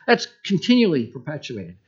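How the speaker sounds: normal talking voice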